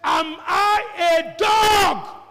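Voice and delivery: slow, drawn-out shouted sneer; Goliath roaring his insult at David; taunting giant. Acted. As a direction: stretch each word in outraged mockery. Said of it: "He says".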